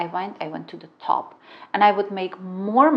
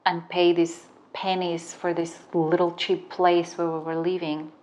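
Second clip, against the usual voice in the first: normal talking voice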